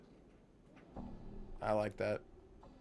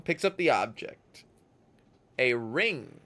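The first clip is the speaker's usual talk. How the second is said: ordinary delivery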